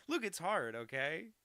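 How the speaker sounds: high, loud plea for a bit of slack; mock-defensive protest. Real, not acted. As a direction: protest loudly, half laughing at yourself. Defends own bad Aussie accent.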